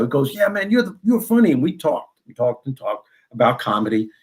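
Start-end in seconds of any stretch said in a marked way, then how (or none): 0.3-1.5 high-pitched mimicry of an excited fan; imitated enthusiasm. Seatmate complimenting the comedian he recognized, enthusiastic.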